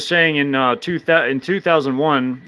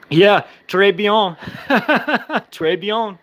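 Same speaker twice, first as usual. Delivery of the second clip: giggly, higher pitched "très bien" through laughter; laughing très bien; 6 semitones higher, slower than usual. Real, not acted. Streamer laughing back at a viewer's French, giggling.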